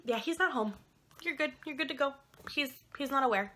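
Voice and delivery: mock the carefree nurse brushing them off. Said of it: "This bitch goes".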